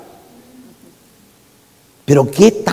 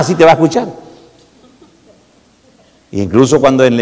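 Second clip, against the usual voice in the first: ordinary delivery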